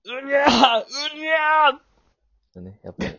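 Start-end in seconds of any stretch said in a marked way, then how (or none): 0.0-1.8 high loud cat-like screech; frustrated screech. Pretend. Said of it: "思い出せんときってどうなるんだったっけ".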